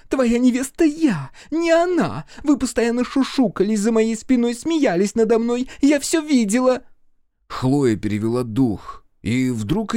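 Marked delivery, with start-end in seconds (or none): 0.1-6.8 high-pitched, squealing tirade; jealous shriek. A narrator's reading. Jealous fiancée accuses Sam over the maid, shriek. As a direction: pour out accusations at a shrill height. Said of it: "взвизгнула Хлоя".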